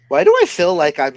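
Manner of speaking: loud. Real, not acted. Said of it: "big, powerful psychic".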